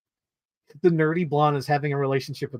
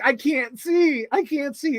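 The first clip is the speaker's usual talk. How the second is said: mock helpless; 9 semitones higher than usual. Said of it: "the cliff guy's like".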